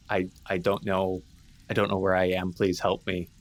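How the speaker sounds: ordinary talk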